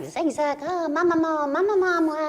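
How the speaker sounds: very high, loud cartoon creature calling for mother; shrill-pitched, wailing call of a lost little creature; Zigzag. Lost cartoon creature searching for its mother, high wailing voice. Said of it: "je faisais les voix".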